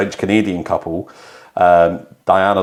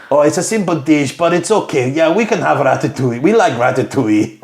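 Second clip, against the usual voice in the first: high, hammed-up French Swiss accent; second go at French accent, higher and singsong; 5 semitones higher than usual. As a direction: imitate a French couple, pitched up. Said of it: "I'm doing French Swiss".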